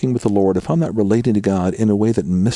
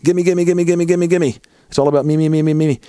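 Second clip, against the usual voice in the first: a mock whiny gimme chant, a little higher and louder; spoiled brat; 6 semitones higher, 3 dB louder than usual. Pastor mocking selfish prayer as a spoiled brat's gimme chant. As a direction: ham up a greedy child begging. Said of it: "functioning like spoiled brats".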